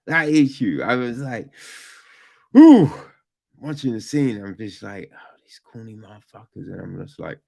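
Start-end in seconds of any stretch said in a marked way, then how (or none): 2.6-2.9 high loud ooh of being hit. Retold or imitated. Reaction watching scene. 5.1-6.7 much softer, quick grumble; muttered. Retold or imitated. Muttered reaction to cheesy scene.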